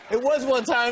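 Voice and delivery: mocking echo. Mocks guest's excuse back.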